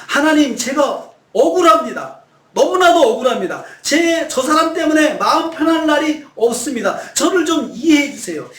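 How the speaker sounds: high, loud pleading cry to God; anguished plea. Acted. Preacher voicing David crying out to God. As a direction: cry out as David, wronged and desperate.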